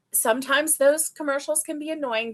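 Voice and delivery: ordinary delivery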